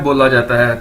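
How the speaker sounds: ordinary talk, nothing marked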